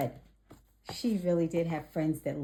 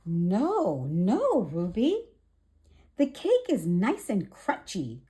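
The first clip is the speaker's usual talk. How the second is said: lively storybook read-aloud; gentle children's story narration. A narrator's reading. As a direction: read it bright and clear for little ones. Reads picture book to children.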